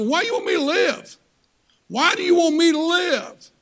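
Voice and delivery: loud, high, pleading cry; loud pleading question to God; full-force preaching. Acted.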